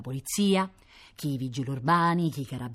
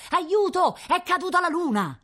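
high, loud panicked cry; baker's boy yelling in panic after his fall; crying for help; 4 dB louder than usual. Acted. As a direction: shout it running into the shop.

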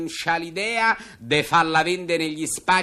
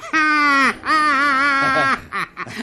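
loud forced laugh; 8 semitones higher, 4 dB louder than usual. Acted. Host laughs at own joke.

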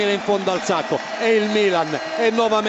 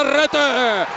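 excited goal call; 6 semitones higher than usual. Real, not acted. Goal call in live commentary.

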